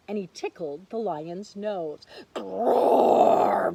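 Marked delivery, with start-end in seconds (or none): 2.3-3.7 huge loud growl; lion roar. Acted. Lion roaring at the mouse, loud growl. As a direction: growl like a big angry lion. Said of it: "roared the lion".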